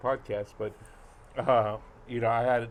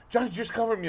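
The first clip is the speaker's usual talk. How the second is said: pleading friend; 10 semitones higher, 4 dB louder, faster than usual. Retold or imitated. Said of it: "she goes".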